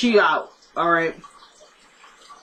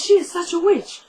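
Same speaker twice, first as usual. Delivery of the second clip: imitating Randall; 7 semitones higher than usual. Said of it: "He goes".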